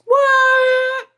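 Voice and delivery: high, loud shocked "What?"; surprised shout. Real, not acted. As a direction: yelp it in disbelief. Surprise at shared hometown.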